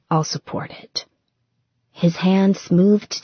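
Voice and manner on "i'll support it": unvoiced, hushed promise; hushed supportive whisper to his fiancée; soft reassurance. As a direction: murmur it like a vow. Tender reassurance in bed.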